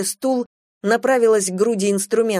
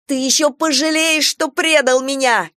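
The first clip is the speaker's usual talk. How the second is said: high, shouted threat, slowing at end; 6 semitones higher, 4 dB louder than usual. Acted. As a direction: scream the vow of revenge. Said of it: "кричала Полина".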